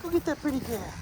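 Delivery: high, soft coaxing to the dog; dog-talk voice. Real, not acted. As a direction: egg the puppy on, sweet and high.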